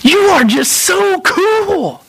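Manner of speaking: high, drawn-out gush of admiration; slow, high-pitched awe; enthusiastic admiration. Acted. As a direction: marvel like an enthusiastic kid. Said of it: "this enthusiasm towards God".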